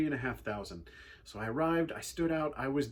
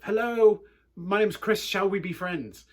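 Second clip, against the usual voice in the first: higher, much louder eager greeting; louder repeat; 6 semitones higher, 8 dB louder than usual. Retold or imitated. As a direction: call it out again so the big kid hears. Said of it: "and then just repeated myself".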